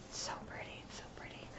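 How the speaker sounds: very soft, voiceless admiring whisper; awed whisper. Real, not acted. Gushing over the beads in her hair, awed whisper.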